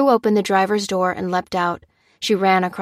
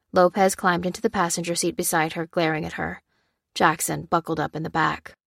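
usual speaking voice

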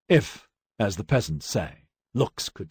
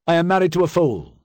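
higher, louder announcement to a room; loud declaration; 8 semitones higher, 5 dB louder than usual. A narrator's reading. Husband passing off the squabble to guests, loud declaration. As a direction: declare it to the whole table, voice raised. Husband complains loudly after wife leaves.

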